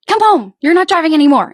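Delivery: high and loud, a hammed-up scolding parent; mock angry mom. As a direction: bark it like a furious mom taking the keys. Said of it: "And you were like".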